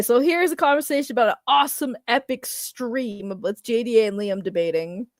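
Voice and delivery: normal talking voice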